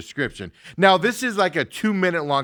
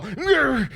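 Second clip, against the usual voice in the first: mock growl; 12 semitones higher than usual. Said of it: "and he's all".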